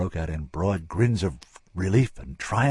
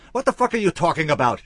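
loud, much higher furious outburst; blazing fury; 11 semitones higher, 9 dB louder than usual. A narrator's reading. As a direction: flare up at him in disbelief. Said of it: "she blazed".

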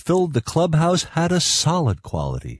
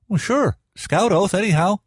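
high-pitched lad's voice; young boy; 8 semitones higher than usual. Acted. Boy answers about taking an oath, high-pitched. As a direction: answer brightly in a raised boyish pitch.

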